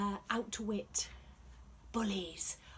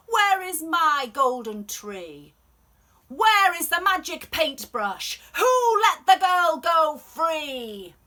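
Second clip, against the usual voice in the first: loud, higher hammed-up royal rage; furious emperor; 6 semitones higher, 13 dB louder than usual. Emperor demands to know who freed Shen, loud and raging. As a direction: rant at the servants like a storybook king.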